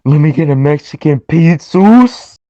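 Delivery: hammed-up fast-food ordering bit; exaggerated Spanish-accent order; mock Taco Bell order.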